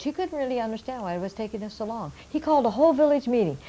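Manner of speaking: usual speaking voice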